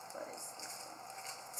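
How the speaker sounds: breathy whisper to herself, forgetting the word; whispered aside. Real, not acted. Librarian whispering to herself, unsure of the craft item. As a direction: drop to a near-silent whisper, searching for a name.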